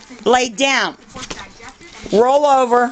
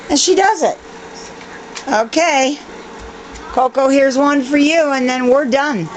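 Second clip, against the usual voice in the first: ordinary talk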